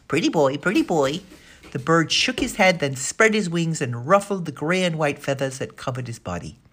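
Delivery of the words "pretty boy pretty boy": parrot voice. Acted. Parrot repeats words.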